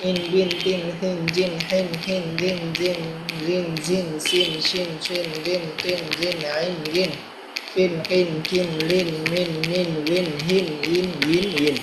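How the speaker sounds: evenly spaced, level-toned syllables; syllable drill recitation. Shown as a demonstration. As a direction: recite the syllables to a steady beat. (সমান তালে উচ্চারণ মশক)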